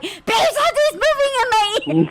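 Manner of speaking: high, frantic alarm; retold panic. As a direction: cry out that something's inside. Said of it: "screaming that whole time".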